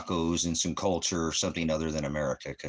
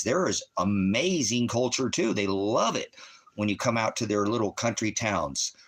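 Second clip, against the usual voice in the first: ordinary talk, nothing marked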